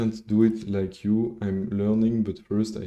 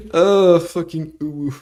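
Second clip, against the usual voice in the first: mock ooh; 8 semitones higher, 9 dB louder than usual. Real, not acted. Mocking chat's message.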